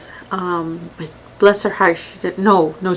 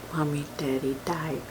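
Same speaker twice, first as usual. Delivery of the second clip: very soft, slightly lower retelling; hushed child; 3 semitones lower, 10 dB quieter than usual. Retold or imitated. Oldest son lying down tells his mother, hushed. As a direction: quote a little boy, barely voiced.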